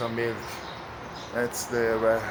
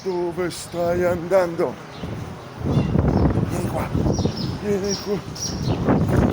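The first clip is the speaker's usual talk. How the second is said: higher, drawn-out coaxing call; calling after a kitten; 7 semitones higher, slower than usual. Real, not acted. Rider calls the runaway kitten back to him, calling out high and slow. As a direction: call it back, slowly, pitched up.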